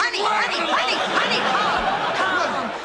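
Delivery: wife urgently calming her angry husband; soothing. Acted. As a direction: settle him down fast, repeating.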